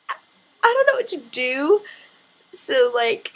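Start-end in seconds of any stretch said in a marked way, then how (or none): 0.1-1.8 high flustered panic. Retold or imitated. Meeting Luke, freaking out.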